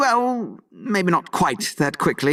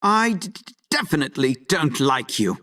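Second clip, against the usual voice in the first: stammered attempt at defiance; stuttering; 9 semitones higher than usual. Rival insisting on dislike to the half-orc, stuttering. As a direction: catch repeatedly on the D, then rush on. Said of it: "yanked a stuttering fool".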